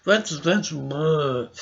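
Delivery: slow, soft mock dismissal; lightly voiced scoffing opponent; dismissive imitation. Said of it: "They're always like".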